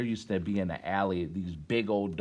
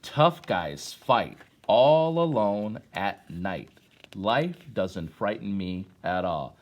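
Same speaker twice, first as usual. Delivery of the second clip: slow, louder, weighty poetry reading; poem recitation; 2 semitones lower, 4 dB louder, slower than usual. Acted. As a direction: lean into the tough line, then ease off. Reads poem to class.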